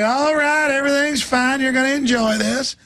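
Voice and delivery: high, sugary false comfort; fake reassurance. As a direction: reassure them like a liar.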